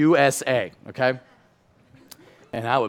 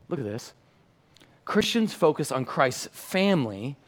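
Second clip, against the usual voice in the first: ordinary talk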